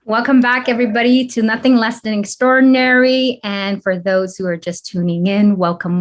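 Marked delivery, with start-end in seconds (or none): 0.0-3.4 podcast intro. Acted. Podcast host opens the episode.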